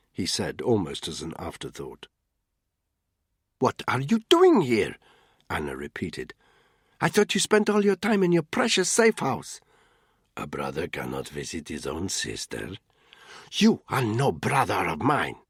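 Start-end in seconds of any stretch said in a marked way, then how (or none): 3.6-15.4 bitter sister. A narrator's reading. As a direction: throw the words at him. Siblings argue.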